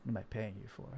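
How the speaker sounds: a boss chewing out his worker; angry boss. Retold or imitated. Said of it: "Producer me is like".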